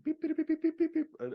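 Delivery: high piping beeps like an emergency tone; imitated alert beeps. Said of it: "we get that news bulletin".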